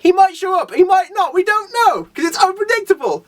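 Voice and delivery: high, sing-song self-mockery; mocking himself. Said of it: "it's like".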